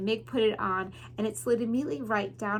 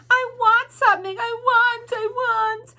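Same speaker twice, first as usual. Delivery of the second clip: high, loud, sing-song wail; keen it like a hurt child; wailing girl; 12 semitones higher, 9 dB louder than usual. Acted. Mig wailing her wish, high loud sing-song.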